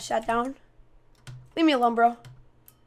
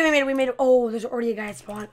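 ordinary talk, nothing marked

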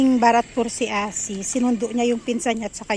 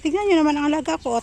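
ordinary delivery

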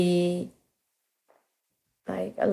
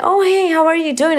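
cheery greeting; 9 semitones higher than usual. Retold or imitated.

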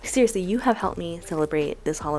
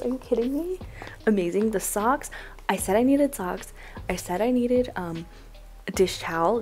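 ordinary talk, nothing marked

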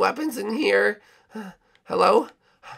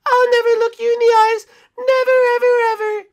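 much higher, loud squeaky refusal; high defiant voice; 7 dB louder than usual. Acted. Dinosaur refusing queen's gaze, high defiant. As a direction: refuse shrill and stubborn.

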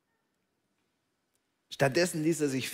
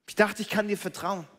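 hopeful; 5 semitones higher than usual. Acted. Disappointed person recalling their certainty, high and eager. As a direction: sound sure of yourself, raised up.

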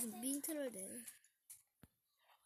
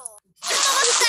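triumphant shout; 8 semitones higher, 7 dB louder, slower than usual. Real, not acted. Triumphant shout after bite.

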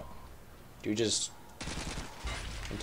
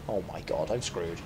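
soft, low and defeated; despairing; 9 semitones lower, 13 dB quieter than usual. Real, not acted. Gamer after a costly mistake, defeated. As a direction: murmur it, giving up.

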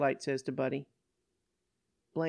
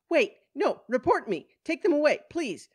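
high, loud plea, dropping on please; desperate cry; 11 semitones higher, 6 dB louder than usual. Acted. Woman spun by girls begging police, desperate cry. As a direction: cry out urgently for rescue. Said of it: "Blaine cries out".

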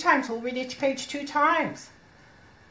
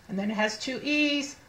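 ordinary talk

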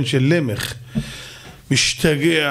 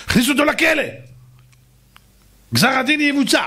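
much higher shouted decree; king shouting a death sentence; royal command shout; 11 semitones higher, faster than usual. Acted. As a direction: bark the order to the guards. King orders execution.

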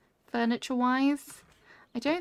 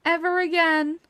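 laughing, higher repeat of "ever again"; laughing echo; 4 semitones higher than usual. Real, not acted. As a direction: giggle out the words as you echo them.

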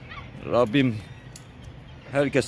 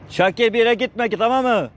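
very high calling voice; calling dog; 11 semitones higher than usual. Real, not acted. Asking dog Şakir not to wander off, very high calling.

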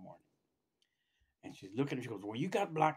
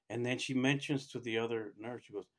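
ordinary delivery

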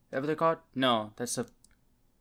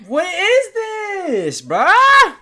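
high, loud and stretched out slowly; stunned outcry; 10 dB louder, much slower than usual. Real, not acted. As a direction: drag out each word in disbelief. Shocked by anime scene.

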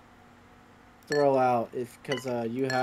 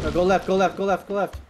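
urgent shouting; 4 semitones higher, 6 dB louder than usual. Real, not acted. Urgent directing teammate.

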